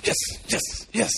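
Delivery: whispered thrilled yes, yes; excited whisper. Acted. Optimistic son spotting the manure, whispered. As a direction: hiss the yeses with glee.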